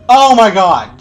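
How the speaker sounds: loud exasperated cry; exasperated shout. Real, not acted. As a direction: blurt it out, exasperated. Player struggling in sequence.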